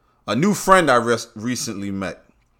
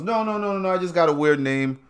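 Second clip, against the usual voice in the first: higher, light no no no; high laughing denial; 7 semitones higher than usual. Retold or imitated. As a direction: wave it off, amused. Clerk laughing it off.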